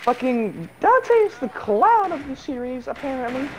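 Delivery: squeaker voice. Shown as a demonstration. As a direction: squeak it out like a kid. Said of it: "doing this voice fucking sucks".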